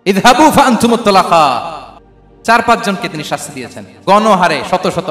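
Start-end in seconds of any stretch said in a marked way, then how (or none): 0.1-1.7 slow, resounding declamation; Arabic recitation. Retold or imitated. Preacher reciting the Prophet's pardon at Mecca, slow declamation. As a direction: recite it slowly, building louder.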